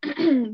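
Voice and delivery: clearing a scratchy throat; hard throat clear. Real, not acted. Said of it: "ich hab irgendwas im Hals".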